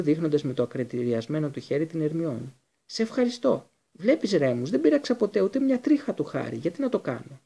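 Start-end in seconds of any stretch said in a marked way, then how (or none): 2.9-7.4 squeaky plea. A narrator's reading. Pettigrew squeaks his defence.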